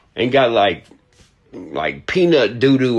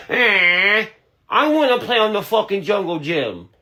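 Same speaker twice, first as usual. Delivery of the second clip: put-on much higher, whiny little kid voice; mocking childish voice; 10 semitones higher than usual. Mocking the man's accent as a whiny kid on the playground. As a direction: ham up a goofy kid begging to play. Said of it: "that's what you sound like".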